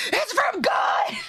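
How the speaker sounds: high, drawn-out mock shriek; retold scream. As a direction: re-enact screaming with joy at a delivery. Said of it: "and I screamed".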